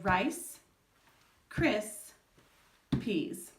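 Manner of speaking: rice, cris, peas said as three separate beats with pauses; syllables broken apart. Shown as a demonstration. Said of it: "she would tap on the side of her leg".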